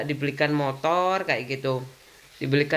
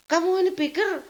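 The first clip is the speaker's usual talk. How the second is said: mock indignant retort; 11 semitones higher than usual. Retold quarrel with husband.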